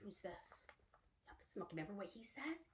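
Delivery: hushed question; whisper. Real, not acted. Teacher whispering to children before the next animal. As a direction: whisper it like a secret.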